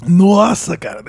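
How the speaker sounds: strained after choking; recovering from swallowing wrong; swallowed wrong way. Real, not acted. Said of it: "Dei uma engasgada aqui".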